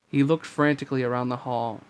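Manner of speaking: ordinary delivery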